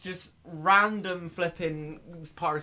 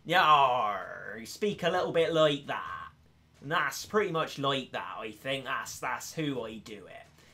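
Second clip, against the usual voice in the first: West Country pirate; 4 semitones lower than usual. Shown as a demonstration. Streamer demonstrates a Devonshire accent, West Country pirate style. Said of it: "stereotypical pirate accent down the West Country".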